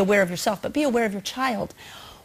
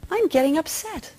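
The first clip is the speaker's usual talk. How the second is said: self-observing; 7 semitones higher than usual. Shown as a demonstration. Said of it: "and you say".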